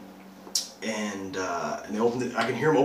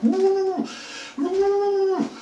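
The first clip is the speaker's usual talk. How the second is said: high, loud wordless hoots; imitated muffled cries; 7 dB louder than usual. Kidnap victim in the trunk, imitated muffled cries. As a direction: wail as if your mouth is taped.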